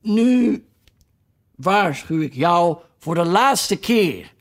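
lightly voiced stern queen, slow; queen's warning. A narrator's reading. Queen threatening the duchess, a slow stern put-on voice. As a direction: warn her once, coldly and slowly. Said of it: "riep de koningin".

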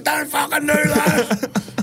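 high, slow, pleading mate voice; hammed-up alarmed mate laughing through it; mock panicked mate. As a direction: squeak it out like a scared accomplice.

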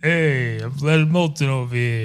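lightly put-on New York voice; a hint of a New Yorker; Leonard Maltin impression. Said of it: "Give us a Leonard Maltin".

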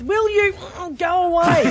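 host voicing the kids' reply; mock grumpy reply. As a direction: fire it back sleepily. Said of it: "they can respond with".

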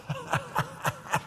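high-pitched mock laughing fit; high, quiet put-on laugh; imitated laughter. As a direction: laugh like an old man collapsed in hysterics. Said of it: "he was down there on his face just laughing".

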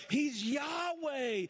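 slow, high shout of "Yahweh"; climactic shout. Acted. Pastor crowning the list of names, slow shout. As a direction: land the final name as a triumphant cry.

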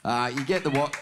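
normal talking voice

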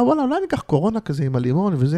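acting out a spontaneous craving, quick and high; sudden impulse. Voices sudden inner impulse.

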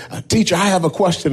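put-on a kid bursting to ask; excited child. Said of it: "very excitedly and said".

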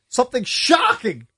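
overplayed suspense with a hint of sarcasm; sarcastic-sounding. Real, not acted. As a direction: tease the shock with a sarcastic edge. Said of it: "that sounded sarcastic".

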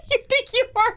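high laughing Canadian friend voice. Pretend. Host as the Canadian friend laughing at the cruise woman. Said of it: "act out the part of her Canadian friend".

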